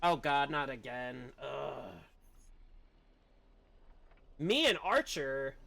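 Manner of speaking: normal talking voice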